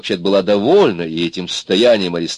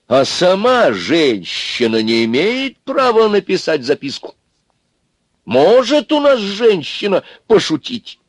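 high-pitched, slow, haughty challenge; 12 semitones higher, 4 dB louder, slower than usual. A narrator's reading. Соня demands a woman's right to joke, haughty. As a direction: lift the voice and draw out each question. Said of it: "спросила она надменно".

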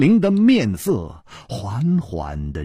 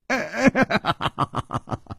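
high breathy bitter laugh; 凄惨的苦笑; 9 semitones higher, 4 dB quieter than usual. Acted. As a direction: laugh in defeat, with no joy in it. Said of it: "楚留香惨笑着说".

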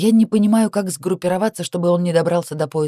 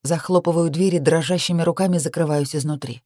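usual speaking voice

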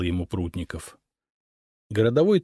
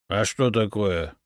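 ordinary delivery

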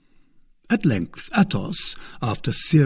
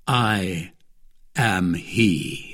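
grave confession; 4 semitones lower, much slower than usual. A narrator's reading. Grave confession after a long pause.

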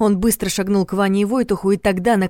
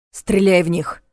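moderately raised command; urgent order; 4 dB louder, slower than usual. A narrator's reading. Vanya orders Voytakh to shoot, raised voice. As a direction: order it firmly and at once. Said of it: "велел Ваня".

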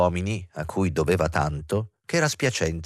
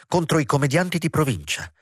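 higher, clipped with scorn; angry; 7 semitones higher than usual. Acted. Professor to actress, mildly angry. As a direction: spit out provincia in irritation. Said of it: "disse con rabbia".

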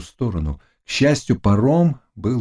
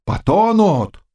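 high-pitched cry; raised, excited call; peasants shouting; 10 semitones higher, slower than usual. A narrator's reading. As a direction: yell it as an onlooker. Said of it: "кричали мужики".

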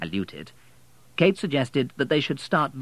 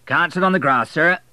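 raised, louder officious porter voice; college porter; 8 semitones higher, 7 dB louder than usual. A narrator's reading. College porter warning the dreaming couple off the grass, raised and loud. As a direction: call them off the lawn like a stern porter.

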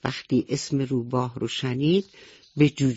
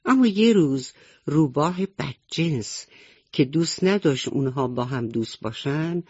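ordinary delivery

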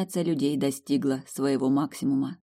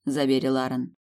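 plain reading voice

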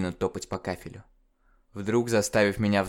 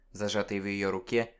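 usual speaking voice

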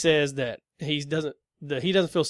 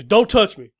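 loud, higher recoil; mock angry snap; 5 semitones higher, 10 dB louder, slower than usual. Temp driver recoiling from a hand, loud offended snap. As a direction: play him jerking away offended. Imitating driver pulling back.

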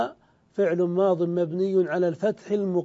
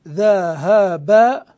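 ذهبت stretched very slowly and louder, each syllable pronounced fully; slow, emphatic build-up; 6 dB louder, much slower than usual. Shown as a demonstration. Teacher joining the root and the speaker's ta for students, slow and loud. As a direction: draw out ذهبت with full vowels as an emphatic example. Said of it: "ثم تاء المتكلم".

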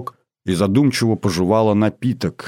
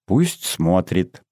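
usual speaking voice

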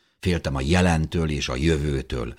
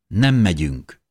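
louder, slightly raised, decisive mother; firm refusal; 4 semitones higher, 6 dB louder, slower than usual. A narrator's reading. Mother answers her excited son, firmly refusing. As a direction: shut the idea down at once. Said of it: "vágta rá anyánk határozottan".

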